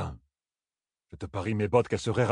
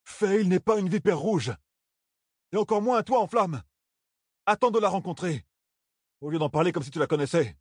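indignant; 7 semitones higher than usual. Acted. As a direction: push back hard on the old man. Perrine angrily defends wife.

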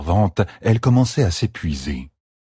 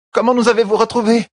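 higher, quicker urgent question; Fail asking; 4 dB louder, faster than usual. A narrator's reading. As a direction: call up through the rubble. (question pressée et plus aiguë)

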